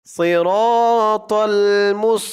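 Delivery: slow tajweed chant, vowels held long; Quran recitation. Shown as a demonstration. Teacher modelling the verse for learners, slow Quran recitation. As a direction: recite it slowly and measured, syllables drawn out.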